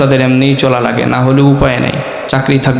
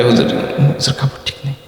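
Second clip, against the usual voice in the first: mumbled excuse; 7 dB quieter than usual. Retold or imitated. Youth excusing himself from prayer, soft and sheepish. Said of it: "দেখবেন কয়".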